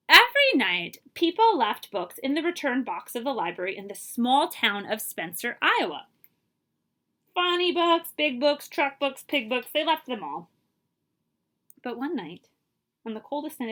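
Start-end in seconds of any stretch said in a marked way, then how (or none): none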